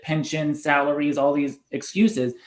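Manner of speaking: ordinary talk, nothing marked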